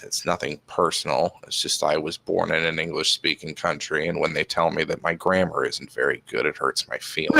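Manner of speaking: low, quiet mock-wounded confession; mock hurt. Answering Pluto's question in low, quiet mock hurt. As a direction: pretend to be deeply hurt.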